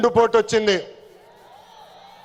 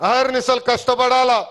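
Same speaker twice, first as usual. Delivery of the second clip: usual speaking voice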